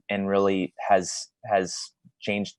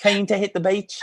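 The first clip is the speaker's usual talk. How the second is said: higher pitched Kiwi accent attempt; 10 semitones higher than usual. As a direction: Kiwi accent, pushed up high. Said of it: "That good?".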